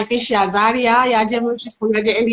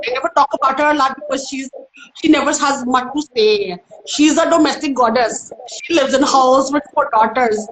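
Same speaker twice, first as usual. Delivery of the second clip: put-on Indian accent, lightly raised pitch; Missus Patel; 2 semitones higher than usual. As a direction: domestic goddess in an Indian accent. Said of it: "there's Missus Patel".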